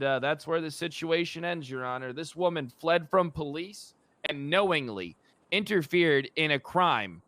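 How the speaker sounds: ordinary delivery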